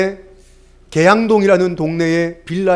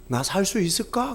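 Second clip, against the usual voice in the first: much softer, hushed question; mother's fearful question; 10 dB quieter than usual. Retold or imitated. Pastor quotes the sick mother's question overheard on the phone, hushed. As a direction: ask it barely aloud, afraid. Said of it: "엄마가 그랬다는 거예요".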